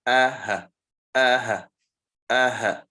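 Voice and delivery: aha with a glottal catch before the first a, then a breathy ha; hamza fatha ha fatha. Shown as a demonstration. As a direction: model aha, both letters clearly separate. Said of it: "You notice the difference?".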